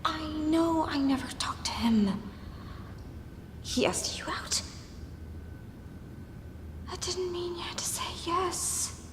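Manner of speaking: slow, hissed protest; drawn-out whispered reproach; strained angry whisper. Acted. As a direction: argue hard while keeping it hushed. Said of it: "trying to keep quiet".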